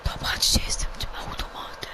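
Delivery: mysterious unseen voice whispering; very soft, voiceless whisper. Pretend. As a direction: whisper it like a hidden spirit voice. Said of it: "możesz mówić głośniej".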